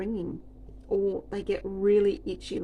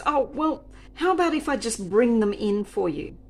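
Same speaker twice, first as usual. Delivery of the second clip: higher, louder wheedling offer; whiny customer; 4 semitones higher, 7 dB louder than usual. Retold or imitated. Customer offering to bring dishes, high and pleading. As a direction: coax like the pushy customer. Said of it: "she goes".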